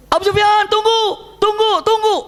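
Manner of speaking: calling out. Acted. Abbas calls after Abu Sufyan, panicked high shout. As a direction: yell after him in a panic.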